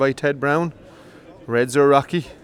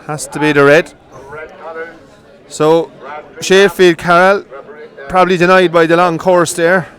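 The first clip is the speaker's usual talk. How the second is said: excited race call; 3 semitones higher, 5 dB louder than usual. Real, not acted. Commentator calling the final to the crowd, excited and loud. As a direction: push the call up as the course turns.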